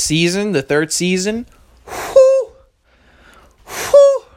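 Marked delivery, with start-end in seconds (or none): 1.9-2.5 very high, loud whistled whew; high, loud whew of awe at the show; hype whew. Shown as a demonstration. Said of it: "that's something me and my friends do whenever we think something's fire". 3.7-4.2 very high, loud whistled whew; high, loud whew of awe at the show; hype whew. Shown as a demonstration. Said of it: "that's something me and my friends do whenever we think something's fire".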